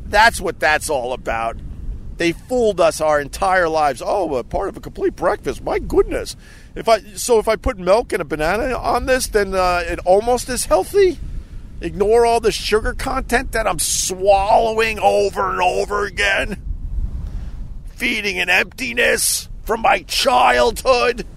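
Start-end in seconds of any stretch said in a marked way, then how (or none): 4.0-11.2 higher-pitched mock amazement; mock gullible buyer. Fooled customer marvelling at a complete breakfast, mock naive. 11.8-21.2 high-pitched rant, slowing into an anguished shout; building mock outburst. Host ranting as if in therapy, hammed-up anguished shouting.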